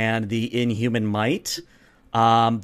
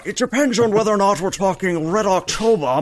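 higher, louder slurred Connery Russian; Connery Russian accent; 7 semitones higher, 4 dB louder than usual. Shown as a demonstration. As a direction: slur the s sounds like Connery. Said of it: "more of a slurring of the sheshes".

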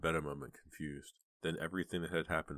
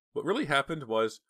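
eager; 10 semitones higher, 7 dB louder than usual. A narrator's reading. Daughter eager to explain.

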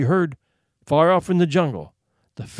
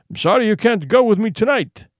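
called out, higher and louder; calling out; 6 semitones higher, 4 dB louder than usual. A narrator's reading. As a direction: call after him across the room. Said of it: "called the general".

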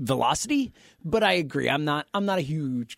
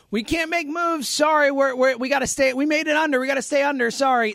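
mock Dodgers front office; 8 semitones higher than usual. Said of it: "Don't be the".

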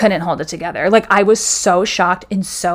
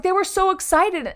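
much higher, strained tearful defence, fading softer; desperate pleading; 11 semitones higher, 4 dB quieter than usual. Retold or imitated. To HR about the burn book, a high desperate plea. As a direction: beg them to understand, voice up high.

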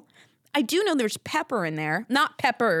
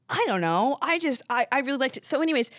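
ordinary delivery

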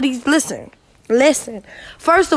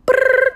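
mock shiver brrr; 4 dB louder than usual. Shivering noise between emails.

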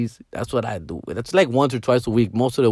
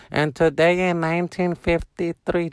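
high, slow droning lecture voice; mock teacher heard from a laptop; teacher impression; 4 semitones higher than usual. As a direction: drone it like an online class teacher. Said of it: "just hear the teacher".

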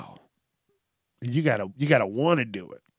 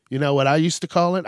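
ordinary talk

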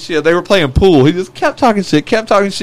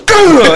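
mock yelp; 10 semitones higher, 5 dB louder than usual. Guy's outburst in bar story.

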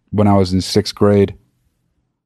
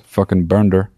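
ordinary delivery

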